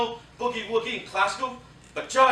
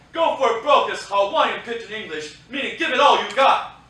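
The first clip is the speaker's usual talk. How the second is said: ordinary talk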